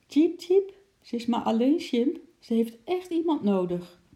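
high, loud little squirrel; chirping squirrel voice. A narrator's reading. Squirrel explaining himself to his chimp friend, high and chirpy. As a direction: plead in a high, chirpy animal voice. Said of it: "chirpte Ekie tegen Sjimp".

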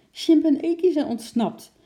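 high, loud alarmed news; worried shout. Acted. Lotje tells the zookeeper the monkeys escaped, alarmed. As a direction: blurt out the alarm. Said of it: "riep ze bezorgd".

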